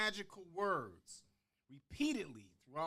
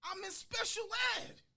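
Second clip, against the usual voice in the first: much higher, whining schoolboy; pleading kid; 12 semitones higher than usual. Retold or imitated. Boy complaining to his dad about schoolwork, high whine. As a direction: plead like a frustrated child. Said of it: "and I said".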